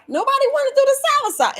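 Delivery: high fast exasperated complaint. Real, not acted. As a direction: rush it out, fed up with the audience. Scolding viewers about soursop.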